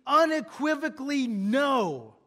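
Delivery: slow, with a heavy weight on "unequivocally no"; emphatic. Shown as a demonstration. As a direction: stretch out "unequivocally" and land hard on "no". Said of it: "He uses this emphatic to say".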